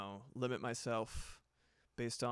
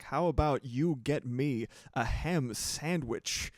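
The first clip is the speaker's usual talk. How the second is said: Walken impression; 2 semitones higher, 7 dB louder, slower than usual. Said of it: "I was like".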